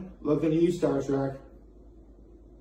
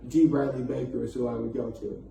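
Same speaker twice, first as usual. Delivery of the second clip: ordinary talk, nothing marked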